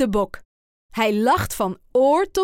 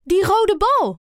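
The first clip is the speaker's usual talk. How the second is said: much higher, louder guess; eager guess; 9 semitones higher, 5 dB louder than usual. A narrator's reading. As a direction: blurt out the answer. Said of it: "raadt hij".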